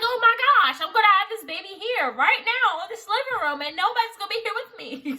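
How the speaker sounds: high, loud panicked yell; mock screaming panic. Alone in the living room, screaming in panic about the baby. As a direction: flip out at the top of your voice. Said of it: "I'm screaming".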